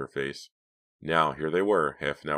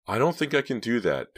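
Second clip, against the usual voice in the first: higher, louder character voice; reluctant young woman; 8 semitones higher, 5 dB louder than usual. A narrator's reading. Driver backing out of the robbery, reluctant. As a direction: refuse in a raised, worried voice.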